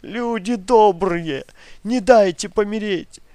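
high, loud anguished wail, names sung out; screaming lament. Acted. Grandmother crying out her children's names in a nightmare. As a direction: sing out the children's names, thin and pained. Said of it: "и закричала".

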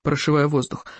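ordinary delivery

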